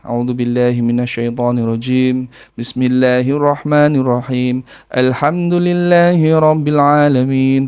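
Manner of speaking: slow, drawn-out Quran recitation; slow devotional recitation for healing; ruqyah recitation. Shown as a demonstration. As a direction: chant the opening verses slowly as in ruqyah. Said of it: "mulai ruqyah".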